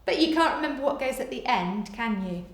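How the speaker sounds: ordinary talk, nothing marked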